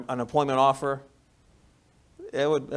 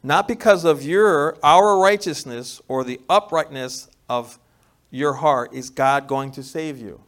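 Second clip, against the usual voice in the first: louder repeated reading; 11 dB louder, slower than usual. Shown as a demonstration. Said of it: "Let me repeat that".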